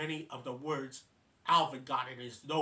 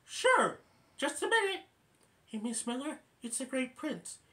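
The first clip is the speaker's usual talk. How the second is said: chipmunk voice on phone; 8 semitones higher, 3 dB louder than usual. Retold or imitated. Chipmunk handing the phone to Miss Miller, high squeaky voice. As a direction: squeak it out, then call across the room.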